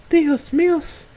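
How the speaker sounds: very high startled cry in Spanish; shocked exclamation. Acted. Rosa hears about the gun at Stella's head, shocked cry. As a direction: gasp it out in horror.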